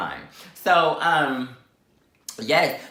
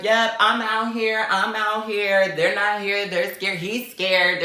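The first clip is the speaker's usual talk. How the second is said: higher, mocking boast; imitated taunting brag; 6 semitones higher than usual. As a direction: crow it like a rival showing off. Imitating rival bragging on Snapchat.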